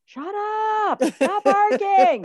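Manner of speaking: loud, high, drawn-out holler; exasperated owner's yell; yelling at the dog. Acted. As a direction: holler at a barking dog from the kitchen. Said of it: "you're in the kitchen and you're going".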